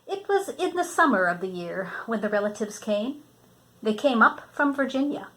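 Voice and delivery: reading a picture book to a grandchild; storybook reading. Acted. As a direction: read it out gently, like a bedtime story. Grandmother reads picture book aloud.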